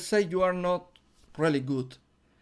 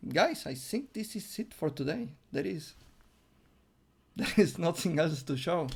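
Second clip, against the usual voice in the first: usual speaking voice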